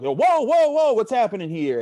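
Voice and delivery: retold shock, voice shooting up loud; alarmed whoa. Group alarmed at cop's order.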